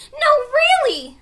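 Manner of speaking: high loud insistence; insisting. A narrator's reading. Annie insisting to Jack it's real, loud insistence. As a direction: make him believe you.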